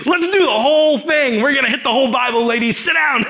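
high, loud, gung-ho eagerness; overexcited. Retold or imitated. Evangelist launching the whole Bible at a listener, overexcited. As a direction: burst out, raring to go. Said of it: "I'm so excited".

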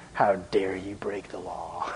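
slow, soft whispered accusation; indignant accusers. Acted. Pharisees confronting the healed man, slow whispered rebuke. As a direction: hiss out the accusation slowly. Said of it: "they're going to this guy going".

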